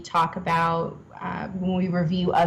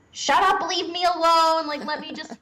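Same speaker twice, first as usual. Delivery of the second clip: acted-out snappy plea, pitched high; high-pitched irritable retort; snappy outburst; 8 semitones higher than usual. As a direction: snap in a high voice, easing down toward the end. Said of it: "I also get really snappy".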